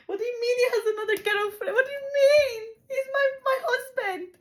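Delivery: high, loud hammed-up sobbing; mock crying. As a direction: wail it in high, loud fake tears. Recounts discovering husband's other girlfriend.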